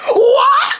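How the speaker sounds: high, loud disbelieving yelp; shocked shriek. Shown as a demonstration. Teen repeating the reaction to mom's plan, shocked. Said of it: "let me say it again".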